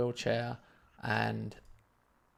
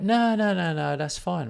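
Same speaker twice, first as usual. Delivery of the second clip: eager refusal; 6 semitones higher, 5 dB louder than usual. Retold or imitated. Kind man refusing payment.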